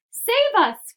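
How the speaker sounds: loud, high shriek of terror; terrified cry. A narrator's reading. Old grandmother crying out in panic, loud high shriek. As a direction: scream for help as the roof caves in. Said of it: "cried Grandma Josephine".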